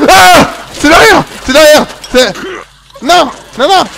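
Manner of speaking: high, loud scream of alarm; panicked scream. Real, not acted. Gamer sees the monster behind, panicked screaming. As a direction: yell the warning in panic.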